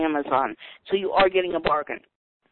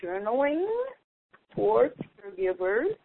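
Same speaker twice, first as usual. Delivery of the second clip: soft, very slow, talking while typing; distant, distracted typing voice; away from the phone; 9 dB quieter, slower than usual. Real, not acted. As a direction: draw out each word as you type it. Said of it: "I'm setting down the phone".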